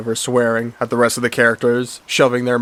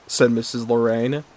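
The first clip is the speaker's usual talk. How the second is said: plain reading voice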